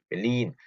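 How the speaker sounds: "벨린" as two syllables, long second; correct German pronunciation. Shown as a demonstration. Teacher models it for learners, end-stressed "벨린". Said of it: "여러분들 읽으실 때".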